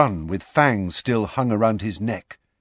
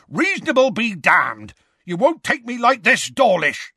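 furious, much higher roar; 10 semitones higher than usual. A narrator's reading. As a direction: roar defiance at the wizards. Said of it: "Hagrid roared".

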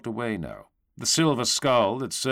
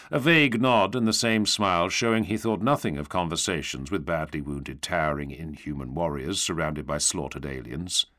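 ordinary delivery, nothing marked

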